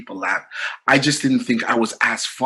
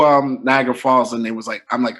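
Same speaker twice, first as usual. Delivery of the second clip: ordinary talk, nothing marked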